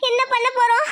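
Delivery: asking through tears; crying. Acted. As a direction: sob out the helpless question. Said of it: "நீ அழுகாத".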